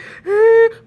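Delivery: put-on loud, high dry gasp like a baby about to cry; mock baby gasp. Mocking a tearless cry, loud high baby gasp. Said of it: "when babies do, when they're just like".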